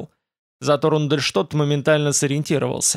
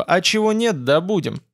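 higher-pitched, cocky assurance; boastful; 6 semitones higher than usual. A narrator's reading. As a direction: toss it out with light bravado. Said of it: "ответил он хвастливо".

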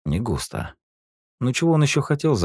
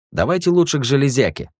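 high, louder cabbie voice; taxi driver; 11 semitones higher, 6 dB louder than usual. Acted. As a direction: suggest it like a friendly cabbie.

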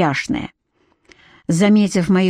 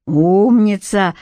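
usual speaking voice

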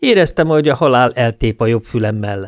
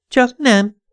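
much higher, coy woman's voice; teasing woman's voice; 13 semitones higher than usual. A narrator's reading. Cindy answers her lover in bed, teasing high voice.

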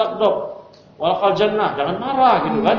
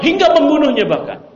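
higher and louder, driving the point home; emphatic; 9 semitones higher, 5 dB louder than usual. Real, not acted. Preacher stresses the husband's rage, higher and louder. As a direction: hit hingga membunuhnya hard and high. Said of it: "Ya, hingga membunuhnya".

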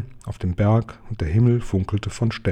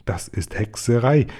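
usual speaking voice

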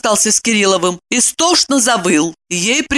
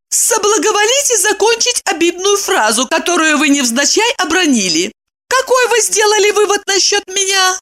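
high, icy-formal challenge; threatening demand; 13 semitones higher than usual. A narrator's reading. Woman demanding the insult be finished, threatening. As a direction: demand an answer with menace. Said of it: "тоном угрозы потребовала".

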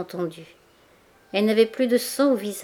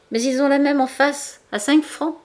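indignant; 7 semitones higher, 5 dB louder than usual. A narrator's reading. Customer complains about price.

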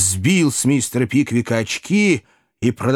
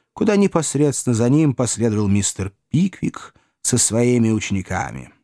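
ordinary delivery

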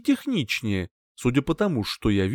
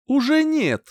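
higher-pitched, mildly proud answer; 11 semitones higher than usual. A narrator's reading. Hero announcing the spider's defeat, mildly proud. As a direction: state it with understated pride. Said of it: "гордо ответил я".

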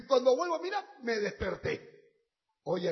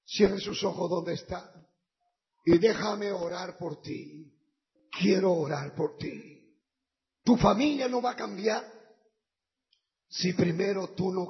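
lower, intimate invitation over music; altar call; 3 semitones lower than usual. Acted. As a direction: call them forward tenderly. Llamado al altar con música. (llamado al altar, íntimo y suplicante)

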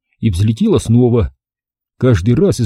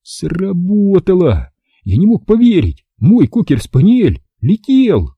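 higher-pitched, stretched-out wonder; amazed excitement; 7 semitones higher than usual. A narrator's reading. Boy marvels at his flying spaniel, amazed. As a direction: draw out the words in high amazement.

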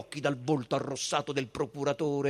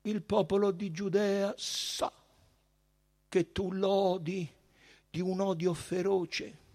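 high, measured priestly voice with pauses; solemn high priest; 8 semitones higher than usual. A narrator's reading. Caifa warns Pilate that his people are protected, high and solemn. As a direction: declare it loftily, without shouting.

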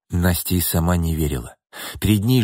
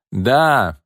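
higher, slowed proclamation; shouting; 6 semitones higher, slower than usual. Acted. Irma declares to everyone she was thought dead, shouting. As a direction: announce it to the whole room. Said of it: "крикнула Ирма".

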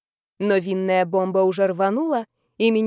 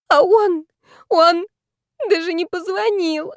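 put-on very high, loud drunken lament; high-pitched, wounded outcry; tragic wail; 9 semitones higher, 5 dB louder, slower than usual. A narrator's reading. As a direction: wail it with theatrical grief. Tipsy heroine bewails the silent lover.

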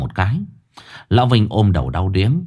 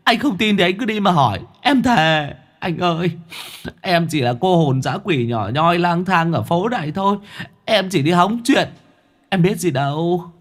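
high, pleading ghost voice; a small stray ghost swearing its innocence; wheedling little ghost; 7 semitones higher than usual. Acted. Stray ghost begging the old man to believe it, high and pleading.

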